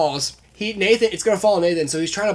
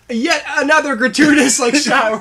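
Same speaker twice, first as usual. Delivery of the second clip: higher and louder, laughing through a mocking gripe; laughing mock complaint; 10 semitones higher, 7 dB louder than usual. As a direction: imitate a friend's griping while cracking up. Quoting Sam's complaint.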